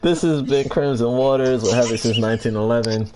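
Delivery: laughing, upbeat announcer outro; podcast outro. Acted. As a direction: sign off like a radio host, laughing.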